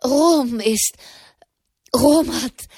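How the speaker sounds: too worked up to finish; panting. A narrator's reading. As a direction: gasp the words between breaths. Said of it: "keuchte Malherbe".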